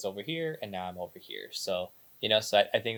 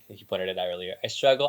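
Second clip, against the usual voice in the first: normal talking voice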